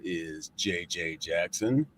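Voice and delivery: normal talking voice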